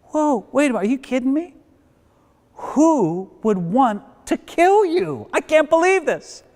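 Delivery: high-pitched acted astonishment; stunned, pitch jumping way up; shocked disbelief. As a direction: play the incredulous king, drawing out the question. King voiced hearing of the plot.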